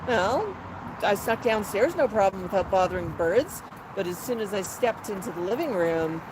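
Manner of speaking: ordinary talk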